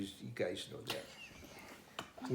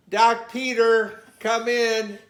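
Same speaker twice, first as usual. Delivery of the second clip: paging over intercom; 10 semitones higher, 10 dB louder than usual. Retold or imitated. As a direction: call it like a hospital announcement. Hospital page over intercom.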